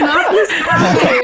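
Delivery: laughing, slow not this guy; playful groan of dislike. Real, not acted. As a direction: drag it out in playful disgust.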